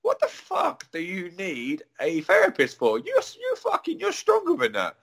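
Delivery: high-pitched, incredulous encouragement; retold pep talk, pitched right up; positive pep talk. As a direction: exclaim it high, urging her on. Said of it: "I'm trying to give her positive energy".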